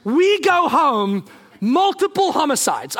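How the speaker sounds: high, slow, emphatic pronouncement; hammed-up dire prediction; dire warning. Foresees disaster if family goes home.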